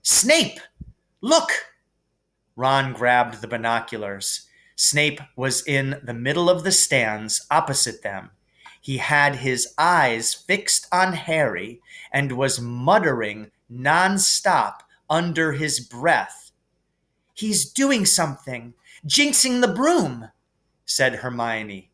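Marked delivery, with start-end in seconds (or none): 0.0-1.5 urgent alarmed cry. A narrator's reading. Hermione alarmed, calling friends. 17.3-20.3 urgent accusation. A narrator's reading. Hermione accusing Snape.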